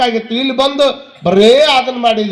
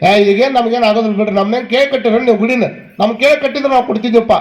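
fast, dismissive refusal; hurried mock excuse; imitated brush-off; faster than usual. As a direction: wave away the donation collectors in a rush. Acted villager refusing temple donation.

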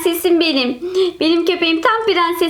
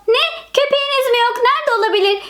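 surprised mother; 8 semitones higher than usual. Acted. Mother hears dogs missing.

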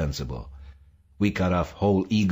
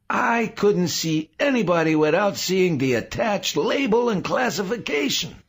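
very high, loud, overwrought outpouring; gasping revelation; 13 semitones higher, 4 dB louder than usual. A narrator's reading. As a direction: pour out the discovery in a high frenzy. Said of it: "Saul gasped".

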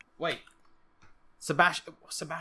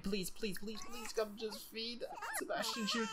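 high, soft, desperate begging; quiet pleading; 6 semitones higher, 12 dB quieter than usual. Pretend. Gamer begging Milani to feed Sebastian Junior, softly pleading. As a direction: beg under your breath, pitch climbing.

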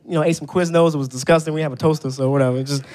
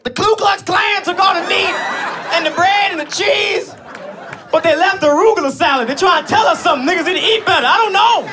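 mock outrage; 13 semitones higher than usual. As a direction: boss shouting his theory with comic alarm. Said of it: "Mister Ross, we talking about who got what?".

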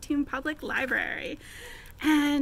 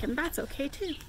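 ordinary delivery, nothing marked

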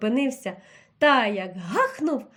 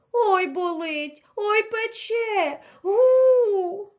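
higher, slow, crying moan of a stung bear; wailing in pain; 8 semitones higher, 3 dB louder, much slower than usual. A narrator's reading. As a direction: whimper and wail like a hurt bear. (ведмідь ниє від болю, високо й повільно)

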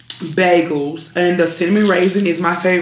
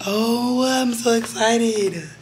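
slow, drawn-out excited gush; 5 semitones higher, much slower than usual. Shown as a demonstration. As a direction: stretch out the excitement, pitched higher. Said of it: "I need to shut up".

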